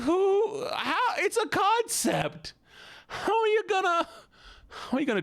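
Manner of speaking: very high, strained squeal of disbelief; baffled mock meltdown. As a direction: stretch it out in hammed-up, squeaky bewilderment. Mock meltdown over a math submission.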